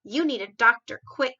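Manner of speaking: a worried king calling out; cried out. A narrator's reading. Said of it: "cried the King".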